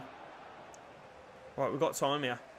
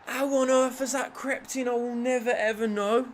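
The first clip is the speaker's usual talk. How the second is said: higher-pitched bewildered complaint; exasperated disbelief; 6 semitones higher than usual. Real, not acted. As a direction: marvel bitterly at how that went in. Disbelief after goal.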